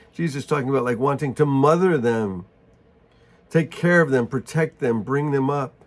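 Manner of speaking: ordinary talk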